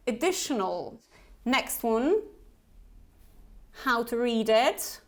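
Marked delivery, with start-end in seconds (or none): none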